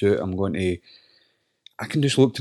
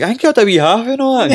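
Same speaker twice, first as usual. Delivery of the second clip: high, louder mock old-man voice; lightly hammed-up grandad teasing; imitating papa; 12 semitones higher, 4 dB louder than usual. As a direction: grandson voicing his joking papa. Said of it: "he was like".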